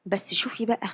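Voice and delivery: usual speaking voice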